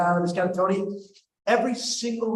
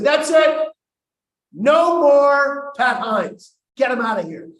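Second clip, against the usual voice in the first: high, loud, drawn-out order; commanding shout; 7 semitones higher, 9 dB louder, much slower than usual. Retold or imitated. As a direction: bellow it like you're in charge. Acted order to remove father.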